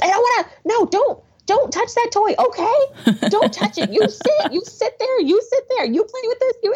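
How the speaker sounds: put-on very high, bossy little-kid voice; bossy toddler. Mom imitating her son snatching toys at his party, squeaky bossy. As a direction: boss the other kids around like a tiny tyrant.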